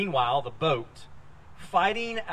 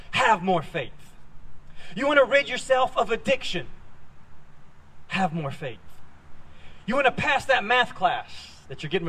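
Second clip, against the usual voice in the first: rising questions, flat punchline answers; mock preaching; 3 semitones higher than usual. Pastor mocking the have more faith sermon, rising questions then flat answers. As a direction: parody a pushy preacher.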